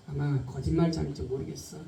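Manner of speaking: low, hushed thought to oneself; inner doubt. Acted. Preacher voices Peter's doubt about Jesus, hushed and low. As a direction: drop right down, as if privately doubting.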